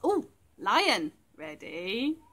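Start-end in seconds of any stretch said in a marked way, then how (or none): none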